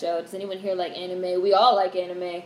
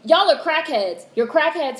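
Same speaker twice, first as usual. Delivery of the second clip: exasperated; 7 semitones higher, 6 dB louder than usual. Real, not acted. Exasperated jab at shoppers.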